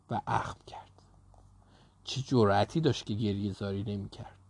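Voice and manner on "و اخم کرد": ordinary delivery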